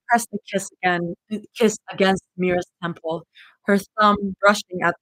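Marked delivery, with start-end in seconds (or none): none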